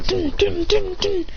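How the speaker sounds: high ringing "dun" hits; imitated clashing. Storyteller imitates kunai clashing, high rhythmic sound effect. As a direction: punch out four high impact noises.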